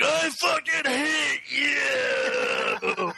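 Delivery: drawn-out, high-pitched anguished scream; Anakin Skywalker. Retold or imitated. Imitating a movie villain screaming hatred at the boss, drawn-out shout. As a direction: stretch every word into a hammed-up wail. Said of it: "like Anakin Skywalker".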